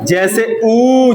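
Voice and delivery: ऊ drawn out slowly with rounded lips; rounded u demo. Shown as a demonstration. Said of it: "होठ गोल हुआ". (होठ गोल करके धीमे से लंबा ऊ)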